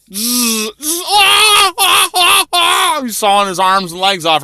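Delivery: buzzing saw noise then high yelps of pain; mock screams while sawing off limbs.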